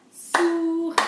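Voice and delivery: "souris" in two clapped beats, high and loud; "souris" split loudly for counting; syllable-by-syllable. Shown as a demonstration. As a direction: stretch "souris" as sou, then ri. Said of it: "Faites-le avec moi".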